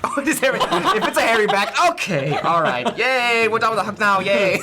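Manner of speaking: loud, high mock-polite yay; prissy, noble discomfort. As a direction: endure a hairy hug with fussy reluctance. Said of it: "more prissy and noble than right that second".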